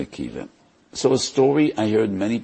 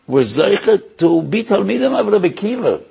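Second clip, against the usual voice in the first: much higher, louder proclamation; impassioned peak; 12 semitones higher, 6 dB louder than usual. Real, not acted. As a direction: lift it to the rafters. Sermon emphasis peak.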